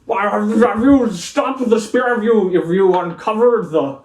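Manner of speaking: loud, keen questions; eager councilman. Acted. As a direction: press them eagerly.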